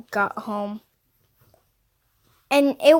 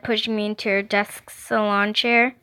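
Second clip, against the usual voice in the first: plain reading voice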